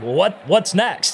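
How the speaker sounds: mild mock confusion; puzzled letdown. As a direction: ask it like the big moment never came. Retold letdown after quick prayer.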